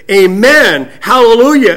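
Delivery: joining in praise. Acted. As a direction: proclaim it as the elders' answering part. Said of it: "they added in their part".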